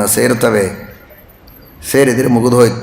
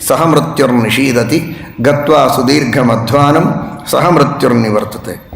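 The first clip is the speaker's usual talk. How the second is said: shloka recitation; 2 semitones lower than usual. Acted. Preacher reciting Rama's verses to the audience, chanted. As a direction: intone each line like scripture.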